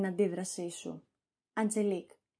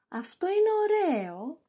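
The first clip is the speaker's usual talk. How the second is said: high, dry ironic remark; 7 semitones higher than usual. Acted. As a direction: say it mocking, not meaning it. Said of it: "ειρωνικά".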